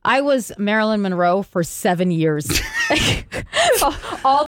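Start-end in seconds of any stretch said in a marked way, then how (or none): 2.5-4.2 high-pitched laughing burst; burst of laughter. Real, not acted. Laughing at own costume history.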